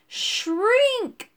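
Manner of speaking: high, drawn-out bellow; exasperated, stretched-out cry. A narrator's reading. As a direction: bellow the word to correct a mishearing. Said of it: "bellowed Urk".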